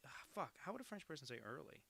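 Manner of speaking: ordinary talk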